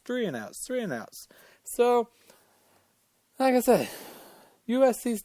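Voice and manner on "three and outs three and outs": fading repetition. Real, not acted. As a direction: trail off softer each time. Repetition dying away to nothing.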